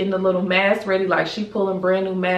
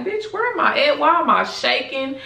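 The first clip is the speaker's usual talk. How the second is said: much higher, bewildered and alarmed; dazed panic; 7 semitones higher than usual. Retold or imitated. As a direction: wake up lost and scared, voice shooting up. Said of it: "I'm like".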